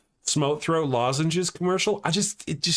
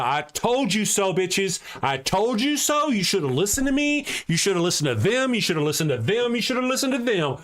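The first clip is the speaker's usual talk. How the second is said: high, mocking told-you-so, rising higher; sing-song I-told-you-so gloat; 7 semitones higher than usual. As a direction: taunt them like a smug know-it-all. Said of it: "I feel like there's a lot of".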